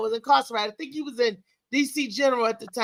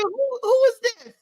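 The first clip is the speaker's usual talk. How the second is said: much higher, slow shocked query; high, drawn-out astonished ask; stunned question; 10 semitones higher, slower than usual. Retold or imitated. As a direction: squeak out disbelief at the stranger. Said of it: "I said".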